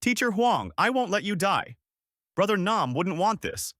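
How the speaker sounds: high, urgent yell. A narrator's reading. Young girl running to save her teacher, high urgent yell.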